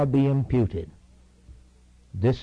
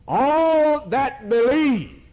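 ALL drawn out high and loud, very slow; stress on all; 12 semitones higher, 5 dB louder, much slower than usual. Acted. Preacher to congregation, booming slow emphasis. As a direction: hit "all" hard and stretch "believe". Preacher driving home the verse.

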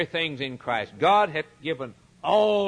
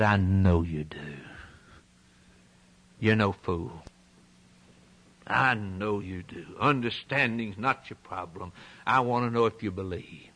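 Paul speaking gently; 8 semitones lower than usual. Retold or imitated. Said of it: "He said".

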